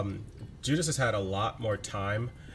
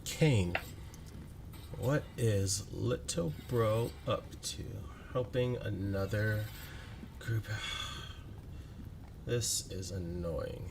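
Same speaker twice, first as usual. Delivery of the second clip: bored drawl; 2 semitones lower, 9 dB quieter, slower than usual. Acted. Bored voice in head.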